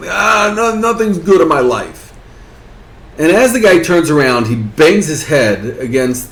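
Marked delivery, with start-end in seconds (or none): 0.1-2.1 higher, dismissive wail; acted despair. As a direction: wave off any hope, hammed-up misery. Despairing jumper brushes off hope.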